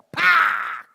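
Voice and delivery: high, explosive "pak" of a smash; loud smash noise. Retold or imitated. Preacher imitating being smashed by wife's words, loud smack sound. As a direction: shout it like a sudden hard hit. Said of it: "kayak kena semes saya".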